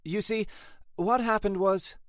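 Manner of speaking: high, flustered attempt at an excuse. A narrator's reading. Late sergeant trying to excuse herself, flustered.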